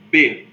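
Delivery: "B" said alone, lips pressed together; sounding the letter B. Shown as a demonstration. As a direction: demonstrate "B" as a clear bilabial stop. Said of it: "Pode fazer aí". (pronúncia clara do "B")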